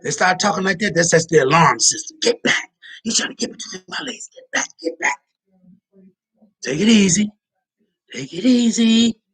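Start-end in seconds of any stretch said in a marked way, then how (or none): 2.2-5.2 whispered high get back; mock whispered panic. Girl voiced whispering alarm. 6.6-9.2 high, slow take it easy; mock soothing girl. Girl voice calming then threatening.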